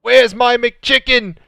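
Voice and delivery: high, loud demanding shout; hungry driver voice. Pretend. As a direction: yell for your McChicken like a spoiled kid. Driver demands food, mock.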